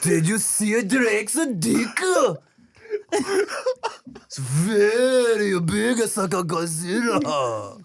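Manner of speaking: slow, high put-on Japanese accent; deadpan Japanese accent. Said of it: "Japanese people, I don't speak like that".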